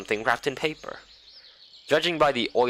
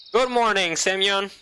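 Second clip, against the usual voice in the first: higher, chirpy camp leader greeting; 8 semitones higher than usual. Acted. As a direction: sing out a bright good morning.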